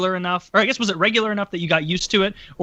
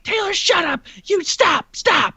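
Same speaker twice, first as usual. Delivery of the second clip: mom shouting; 11 semitones higher, slower than usual. Retold or imitated. Mom fed up with her screaming son, high shout. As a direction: yell him into silence. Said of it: "she was like".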